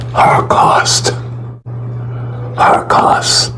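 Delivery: breathy whispered word, grave; hushed. Real, not acted. Preacher naming the horror to the congregation, whispered. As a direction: drop to a whisper on Holocaust.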